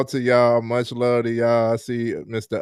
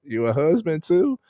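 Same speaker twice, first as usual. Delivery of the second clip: jumps far higher on the last question; playful imitation of an impressed friend; mock amazed onlooker; 8 semitones higher than usual. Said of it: "And then it's like".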